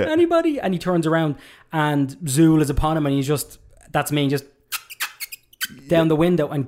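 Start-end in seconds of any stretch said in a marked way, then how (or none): 0.0-0.6 very high, louder nervous begging; mock frantic plea. Rick Moranis's character at the window, hammed-up high panic.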